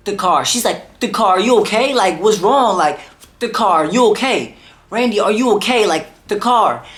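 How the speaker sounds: frantic grandma. Retold or imitated.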